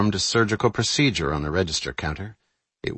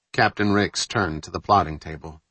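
ordinary delivery

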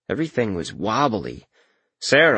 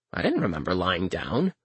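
ordinary delivery, nothing marked

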